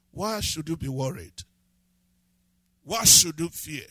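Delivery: ordinary delivery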